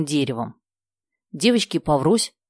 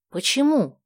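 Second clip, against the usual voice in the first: high-pitched anxious question; a touch of fright, pitch jumping up; alarmed; 9 semitones higher than usual. A narrator's reading. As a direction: ask with sudden worry. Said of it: "испугалась Муся".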